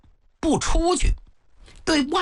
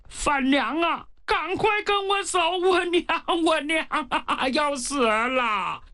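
crying plea; 4 semitones higher than usual. Acted. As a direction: wail with tears in his eyes. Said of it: "眼圈里呀含着眼泪". (含着眼泪哭喊)

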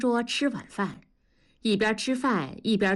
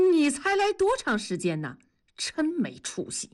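raised-pitch reproach; scornful scolding; 6 semitones higher than usual. Acted. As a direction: scold a buddy in mild disbelief. Dazhuang scolds his friend. (恨铁不成钢地数落)

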